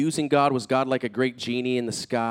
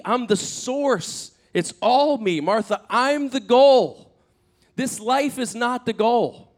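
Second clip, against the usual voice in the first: high, slow, forceful declaration; emphatic proclamation; 7 semitones higher, 4 dB louder, slower than usual. Retold or imitated. Jesus telling Martha he is the goal, emphatic. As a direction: drive each claim home with drawn-out stresses.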